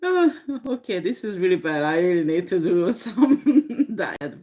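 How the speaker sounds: talking through real giggles; recovering from a laughing fit, giggles breaking in. Real, not acted. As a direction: try to carry on while still laughing. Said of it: "Sorry for laughing at".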